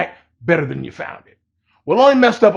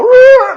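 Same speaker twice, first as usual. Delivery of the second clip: loud, high imitated engine roar; revving engine roar; 11 dB louder than usual. Mimicking the buyers' car roaring in front of Miguelito, a loud high engine roar. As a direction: mimic a car revving hard as it cuts him off. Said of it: "like this".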